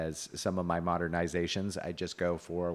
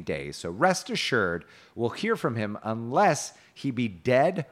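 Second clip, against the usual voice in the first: measured, emphatic storybook quoting; declaimed knight's speech; 3 semitones higher, 4 dB louder than usual. A narrator's reading. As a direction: recite the courteous knight's assurance. Reads Gawain's speech.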